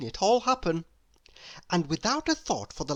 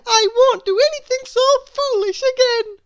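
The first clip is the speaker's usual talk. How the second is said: put-on very high, bright and louder little girl; Little Miss Somersault; 9 dB louder than usual. A narrator's reading. Little Miss Somersault brushing off Mister Worry, very high and loud. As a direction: chirp it way up high.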